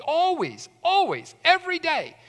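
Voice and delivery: always, always pitched high and hit hard; emphatic insistence. Acted. Pastor insisting to the church that staff are always there, high and emphatic.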